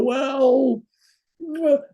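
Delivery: high, wavering well; acted-out reluctant hedging. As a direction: hem and haw, pitched well up. Reluctant answer to wife.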